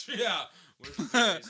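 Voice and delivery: laughing. Real, not acted. Laughing at the hesitation.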